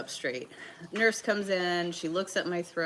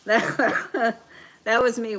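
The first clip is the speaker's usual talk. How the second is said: high, loud words through laughter; laughing; 6 semitones higher, 8 dB louder, much slower than usual. Real, not acted. Streamer relates to viewer's story, laughing hard. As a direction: keep restarting as the laugh takes over.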